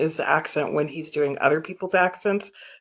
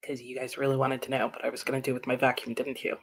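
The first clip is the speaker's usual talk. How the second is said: dry mock aside; 6 semitones lower, 9 dB quieter, faster than usual. Real, not acted. Joking aside to viewers.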